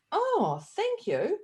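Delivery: imitating an old lady caught off guard; delighted surprise. Old woman thanked for compliment.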